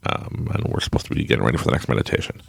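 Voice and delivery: ordinary delivery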